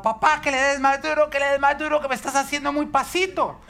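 put-on high, loud tantrum of a small boy; sustained high-pitched mock yelling; imitated angry shouting. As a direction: mimic a cross kid yelling orders at dad. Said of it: "empezaba a gritar bravo".

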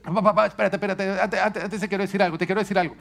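put-on high, hurried little boy voice; bargaining child. Son stalling his dad before the spanking, high and eager. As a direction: stall for time like a clever kid. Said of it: "me la trata de negociar".

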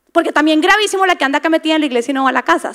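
normal talking voice

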